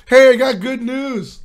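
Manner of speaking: high, bright good-news voice; chirpy upbeat announcement; cheerful doctor. Retold or imitated. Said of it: "The doctor calls me up like".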